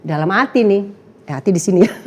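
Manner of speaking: normal talking voice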